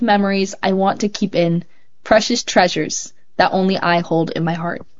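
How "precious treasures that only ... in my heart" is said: ordinary delivery, nothing marked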